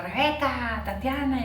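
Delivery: high-pitched imitation of kids calling out names; retold shouting. Schoolkids shouting names at the candidate's children, a mock high call. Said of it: "nos gritaban".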